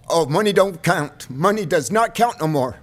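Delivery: loud, bitter protest; loud angry retort. Real, not acted. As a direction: slam it back at them loudly. Angry retort at supervisors' money talk.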